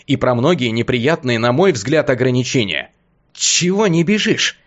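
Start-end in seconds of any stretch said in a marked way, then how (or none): 3.3-4.6 slow hissing taunt. Acted. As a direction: hiss the question out slowly. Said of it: "шипящим голосом поинтересовался я".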